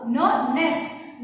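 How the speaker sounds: न नेस्ट slowed, the न hummed before नेस्ट; slow n sound demo. Shown as a demonstration. Teacher modelling the n in nest, slowly. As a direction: draw out न, then say नेस्ट. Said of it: "न, क्या बोलना है?".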